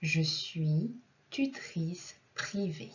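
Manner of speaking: slow, careful model sentence with pauses between words. Shown as a demonstration. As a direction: separate each word clearly for learners. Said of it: "tout ensemble, all together". (lentement, mot par mot)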